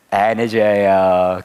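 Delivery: 안 해줘요 stretched out slowly; slow drawn-out punchline. Real, not acted. Preacher driving home the point to the congregation, slowly. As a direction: let it land, word by word. Repeats the punchline about God.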